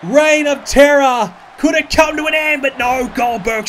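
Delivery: excited. Real, not acted. Hyping the wrestling match.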